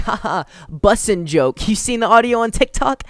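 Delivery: high, silly mock of a joking guy; mock voice of someone cracking a meme joke; goofy mock voice. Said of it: "him trying to be like".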